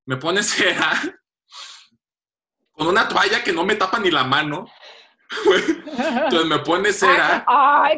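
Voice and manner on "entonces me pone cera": laughing through the words, slow; giggling as he tells it. Real, not acted. Telling friends about the waxing, laughing.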